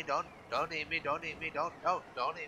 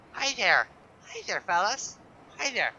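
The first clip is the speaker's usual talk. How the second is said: cutesy greeting; 8 semitones higher, 5 dB louder than usual. Acted. Greeting cute sea creatures.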